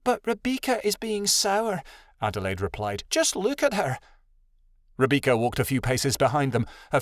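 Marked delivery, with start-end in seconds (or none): 0.0-1.8 high, plaintive objection; childlike protest. A narrator's reading. Adelaide objects to walking by Rebecca, high and plaintive. 3.1-4.0 high, pleading complaint; childlike protest. A narrator's reading. Adelaide complains to Tattle about Rebecca, high and plaintive.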